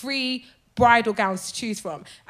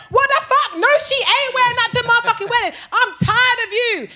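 high, loud, outraged rant; furious sister; 10 semitones higher, 4 dB louder than usual. Retold or imitated. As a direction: imitate the angry sister, yelling high. Acts the bride's furious sister.

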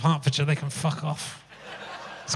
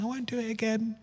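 put-on soft, childlike promise; son's promise; 3 semitones higher, 10 dB quieter than usual. Son promising after two days, soft small voice. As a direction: say it small and contrite like a little boy. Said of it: "He said".

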